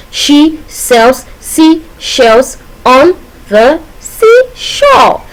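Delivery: very slow, separated words, rising high on seashore. Shown as a demonstration. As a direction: pause after every word, lift the last one. Breaking down the repeated consonant sounds.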